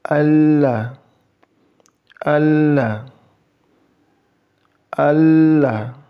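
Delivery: Allah recited three times, "ll" held long and heavy; Allah with held lam. Shown as a demonstration. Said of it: "saya tunjuk satu-satu".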